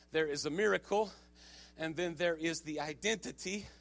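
very soft, low, careful teaching voice with pauses; hushed preaching. Acted. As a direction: draw the congregation in quietly. Hushed teaching after the climax.

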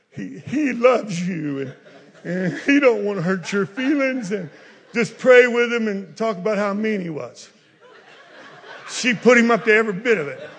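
loud, high, laughing through the story; laughing preaching. Acted. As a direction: tell it loud and amused.

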